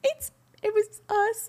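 imitating a small, high sheepish admission; retold frozen confession. Caught pranksters confessing to sister, small high voice. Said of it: "We were, like, frozen".

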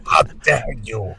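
mock her outrage quietly; Greta Thunberg impression. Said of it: "It's, it's Greta Thunberg".

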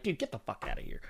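muted, offhand retort; dismissive retort. Retold or imitated. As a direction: brush them off quietly and fast. Retold reply to complainers, muttered.

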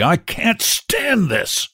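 high, slow, desperate cry; hoarse cry. A narrator's reading. Father begs the nurse to hurry, desperately. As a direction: stretch it out in desperation. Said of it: "he cried hoarsely".

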